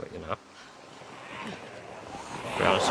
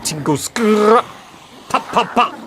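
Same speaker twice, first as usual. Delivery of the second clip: mock rap ad-libs; 10 semitones higher, 3 dB louder than usual. Roadman imitation, ad-lib noises.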